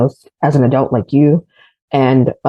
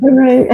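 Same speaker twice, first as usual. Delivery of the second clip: phone ring imitation; 10 semitones higher, 4 dB louder than usual. Mimicking a phone ringing.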